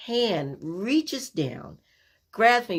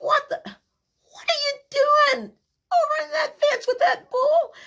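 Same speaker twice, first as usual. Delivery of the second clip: high, loud, slow disbelieving brother; alarmed big brother; 5 dB louder than usual. Retold or imitated. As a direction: scold the kid sister in shock. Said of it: "he's like".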